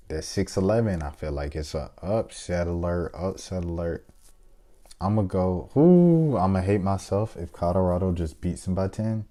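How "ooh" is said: anxious ooh. Real, not acted. Torn over upset pick.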